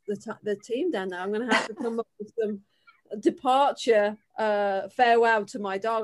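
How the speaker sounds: ordinary delivery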